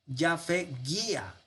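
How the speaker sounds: yafe guía slowly, split as yafe then guía; corrected Hebrew reading. Shown as a demonstration. Teacher correcting his Hebrew reading for students, slow careful yafe guía. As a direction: sound out yafe guía syllable by syllable. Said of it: "perdón".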